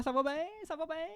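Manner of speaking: high, lightly put-on Québécois reply; hint of a mock Québécois accent. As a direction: imitate the French doing Québécois. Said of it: "des fois, ils nous disent".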